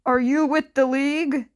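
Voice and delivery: calling out, tense and fast. Acted. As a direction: call out into the dark, on edge. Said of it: "Gang Orca called out, voice leaking with tension".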